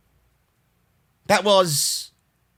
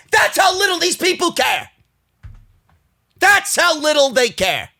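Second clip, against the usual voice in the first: real angry yelling; 8 semitones higher than usual. Real, not acted. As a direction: shout it out, genuinely pissed. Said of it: "You guys think I'm yelling".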